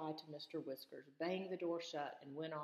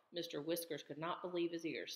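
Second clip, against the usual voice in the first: ordinary delivery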